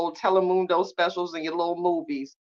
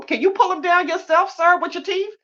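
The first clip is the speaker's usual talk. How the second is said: much higher, sassy mock retort; sassy comeback; 12 semitones higher than usual. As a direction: toss it back at him with attitude. Sassy retort to man in bed.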